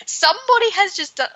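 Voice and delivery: high, incredulous rant; exasperated outburst. Real, not acted. Reader ranting about the commentator's reaction, incredulous and high. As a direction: voice jumps up in disbelief.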